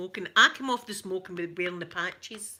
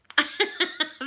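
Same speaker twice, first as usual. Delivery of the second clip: laugh; 8 semitones higher, 6 dB louder than usual. Real, not acted. Laughing at her own joke.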